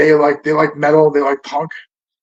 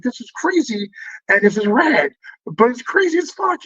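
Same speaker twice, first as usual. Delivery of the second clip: higher, giddy voice rising to its peak; amazed inner quote; 6 semitones higher than usual. Retold or imitated. Teen at a wild punk show, higher giddy amazement. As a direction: marvel at the chaos, half thrilled. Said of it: "I'm like".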